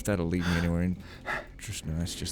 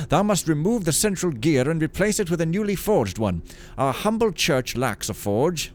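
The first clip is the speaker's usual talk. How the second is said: raised, formal priestly letter voice; old priest reading letter; 5 semitones higher than usual. Acted. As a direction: read it like a pious old priest.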